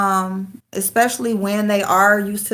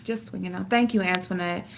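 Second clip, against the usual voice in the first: ordinary talk, nothing marked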